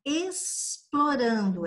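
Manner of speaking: "explorando" slowly, drawing out the S in "es"; teacher's slow "explorando", X sounded as S; demonstrating the S sound. Shown as a demonstration. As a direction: let the S ring in "explorando" before the rest. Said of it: "E olha o som".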